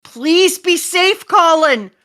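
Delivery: high, loud, drawn-out cry; desperate plea. Acted. Father pleading for his hostage son, desperate. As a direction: stretch out an anxious appeal.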